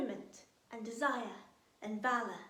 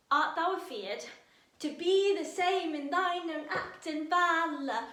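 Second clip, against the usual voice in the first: raised, loud, slow Shakespeare recitation, tapping the beat; rhythmic verse reading; 5 semitones higher, 6 dB louder than usual. Shown as a demonstration. Teacher reads Lady Macbeth aloud to the class, loud and rhythmic. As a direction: declaim it, feeling the iambic rhythm. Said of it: "let's really find the musicality of it".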